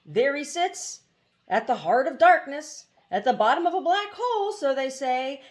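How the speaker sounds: a frightened mechanical bird spooking itself, ending in a nervous high laugh; fearful hoopoe. A narrator's reading. Hoopoe tells a scary legend.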